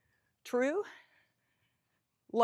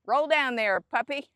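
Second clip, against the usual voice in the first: raised, higher cheering call; calling to the ball; 3 semitones higher, 10 dB louder than usual. Real, not acted. Golfer urging the ball down the green, calling out. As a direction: urge it along, louder and higher.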